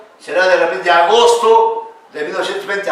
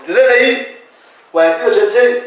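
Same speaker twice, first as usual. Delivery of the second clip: much higher, louder mock announcement of a number; quoted voice; 8 semitones higher, 6 dB louder than usual. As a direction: call the number out like someone telling you. Someone voiced stating your DNI.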